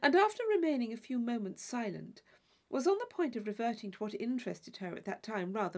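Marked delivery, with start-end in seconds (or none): none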